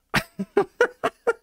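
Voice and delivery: staccato, squealing-high laughter; high burst of laughter; high-pitched laugh. Real, not acted. Laughs after finishing the impression.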